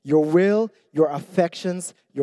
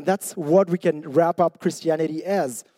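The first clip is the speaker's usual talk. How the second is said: usual speaking voice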